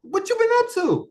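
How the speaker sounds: high, lilting mock catch-up question; high friendly question. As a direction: sing it up high like an old friend. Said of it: "When you don't talk to somebody".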